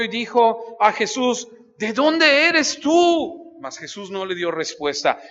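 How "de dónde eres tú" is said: Pilate's question. Acted. Fearful Pilate questions Jesus.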